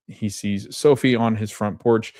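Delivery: usual speaking voice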